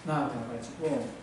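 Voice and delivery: ordinary talk, nothing marked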